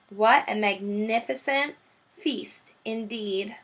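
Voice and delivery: usual speaking voice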